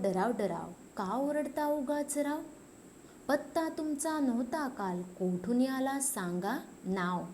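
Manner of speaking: singsong rhyme for a class; poem recitation. Acted. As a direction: recite the verse to young pupils. Teacher recites poem stanza.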